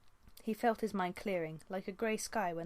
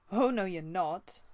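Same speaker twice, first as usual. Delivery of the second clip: louder, slower firm refusal; sharp retort; 6 dB louder, slower than usual. Acted. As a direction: shut her down firmly. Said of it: "Brambleclaw retorted".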